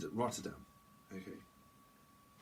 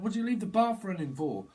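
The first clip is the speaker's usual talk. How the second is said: exasperated; 8 semitones higher, 5 dB louder than usual. Acted. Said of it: "It's like".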